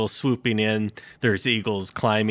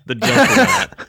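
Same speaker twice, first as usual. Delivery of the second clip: announcer voice; 8 dB louder than usual. Acted. Announcing the villain name.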